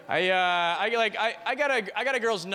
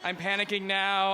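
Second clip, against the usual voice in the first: ordinary delivery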